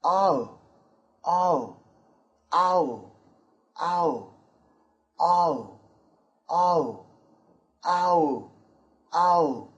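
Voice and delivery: 'ow' drawn out, clearly, for repeating; model sound ow. Shown as a demonstration. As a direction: say 'ow' slowly for students to repeat. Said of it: "very close".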